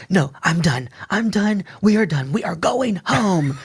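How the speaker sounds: rising, frantic refusal; panicked, insisting on going home; retold freak out. Freak out at the plug.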